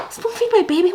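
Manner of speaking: high, disbelieving repeat; mock outrage at the nurses; incredulous echo. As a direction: throw it back in disbelief.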